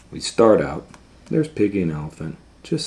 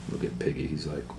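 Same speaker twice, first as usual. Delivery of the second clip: ordinary delivery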